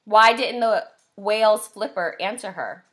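ordinary delivery, nothing marked

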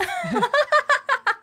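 high, loud burst of laughter; shrieking laugh. Real, not acted. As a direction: cackle in shock. Reaction to insult.

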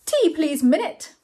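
high, loud call to a servant. A narrator's reading. Bernard ordering tea from his butler, calling out. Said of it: "cried Bernard Clark".

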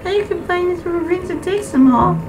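high-pitched little character; child villager voice. Acted. Kid villager talking about the hunt, high character voice.

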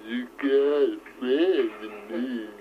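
slow, drawn-out singer-songwriter croon. Pretend. Showing a jaw-straining singer impression, slow drawled croon. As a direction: jut the jaw out and drag each word. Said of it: "my [name] hurts my jaw".

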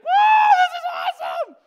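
high, loud thrilled whoop; son's thrilled shout. Retold or imitated. Son at the coaster's top, shouting with glee. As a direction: yell it like a thrilled kid on a coaster.

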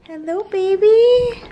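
sweet dog greeting, high and drawn out; pet voice. Real, not acted. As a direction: coo a hello to the dog.

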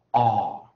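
"R" held long and loud with an American r; drawn-out R-colored vowel. Shown as a demonstration. Teacher models A plus R for students, drawn-out R-colored vowel. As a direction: stretch "R" loudly, curling into the r. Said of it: "我们就念".

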